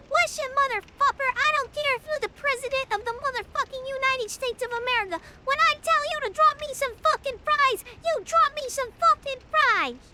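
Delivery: high, loud furious rant at the trainee; retold yelling. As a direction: chew out the new guy in front of everybody. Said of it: "me yelling at him like that".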